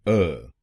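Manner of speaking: अ said alone as a short neutral vowel; syllable demo. Shown as a demonstration. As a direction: model the syllable अ for learners. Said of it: "चौथे शब्दांश को बोलिए अ". (शब्दांश अ अलग से बोलें)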